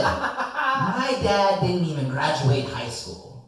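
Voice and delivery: slow, loud, laughing comeback; laughing retort. Retold or imitated.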